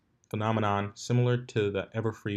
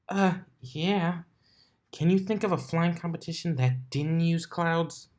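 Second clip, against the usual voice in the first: brash tomboy pony, pitched up; higher Rainbow Dash voice; 8 semitones higher than usual. Acted. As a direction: voice her cocky and a bit exasperated.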